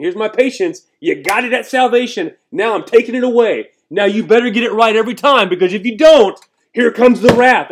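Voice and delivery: high, loud, forceful ultimatum; mock-stern threat. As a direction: thunder a warning, building to the last words. Mock God threatening to withdraw patience.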